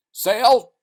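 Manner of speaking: loud high call. Acted. Rescuers hailing the man, loud call. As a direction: call his name across the rocks. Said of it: "they called".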